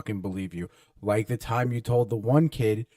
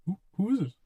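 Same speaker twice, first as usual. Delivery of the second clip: scared stammer; 10 semitones higher, faster than usual. Retold or imitated. Scared friend on the phone.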